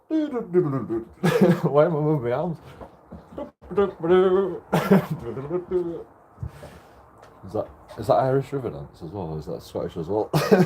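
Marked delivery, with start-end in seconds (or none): 3.7-6.0 laughing, high mouthed jig tune; mock Riverdance music. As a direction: sing out nonsense Riverdance syllables, higher.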